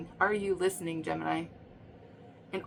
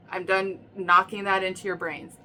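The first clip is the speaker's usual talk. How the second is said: ordinary talk, nothing marked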